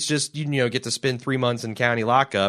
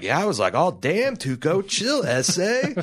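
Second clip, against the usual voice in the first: lightly acted stoner fronting, pitch climbing; a touch of put-on street bravado; Skinny Pete; 6 semitones higher than usual. Podcaster imitating a meth head bragging about a dealer he doesn't know, mock cool. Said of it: "he's also fronting for a person he doesn't even know".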